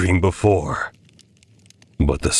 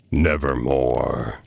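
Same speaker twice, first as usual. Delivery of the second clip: low, slow croak of a raven; raven's reply; 6 semitones lower, slower than usual. A narrator's reading. The raven answers the man, low and slow. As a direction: drag it out, dark and low. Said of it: "Quoth the raven".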